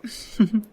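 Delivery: a brief light laugh at the insult; short amused laugh. Acted. Lawyer laughing off her old friend's insult, light laugh. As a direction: laugh it off, unbothered.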